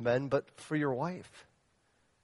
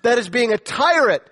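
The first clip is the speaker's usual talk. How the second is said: forceful condemnation; 8 semitones higher, 11 dB louder than usual. Acted. Condemning tyrant husbands, then repeated calmly.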